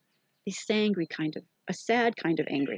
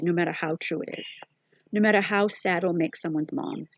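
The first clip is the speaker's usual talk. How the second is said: usual speaking voice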